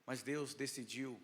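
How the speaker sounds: ordinary delivery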